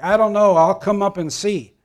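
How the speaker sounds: put-on old woman hollering back; yelling back. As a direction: shout it up the stairs to your sister. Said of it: "yells back".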